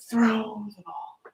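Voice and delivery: slow whispered throw the ball. Shown as a demonstration. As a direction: whisper it slowly to the kids. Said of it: "What about throw the ball?".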